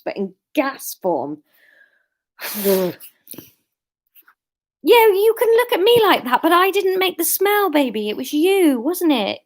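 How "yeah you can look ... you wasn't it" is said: high, sing-song voice for the dog. Real, not acted. Owner teasing her dog in high baby talk. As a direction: coo accusingly at the pet.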